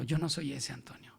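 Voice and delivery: whispered divine correction; whispering Spirit; whispered rebuke. Acted. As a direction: whisper it like a voice within. Holy Spirit rebukes the pastor.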